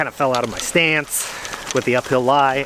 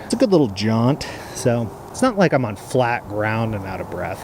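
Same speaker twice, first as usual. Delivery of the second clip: usual speaking voice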